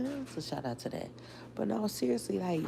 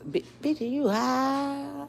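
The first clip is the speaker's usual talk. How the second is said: Stefan voice; 11 semitones higher, 6 dB louder than usual. Retold or imitated. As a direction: squeal it like a scandalised man. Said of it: "In my Stefan voice".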